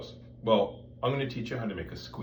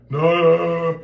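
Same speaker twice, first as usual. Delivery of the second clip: breathy air stream; 6 semitones higher, 11 dB louder than usual. Shown as a demonstration. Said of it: "that's a pillow".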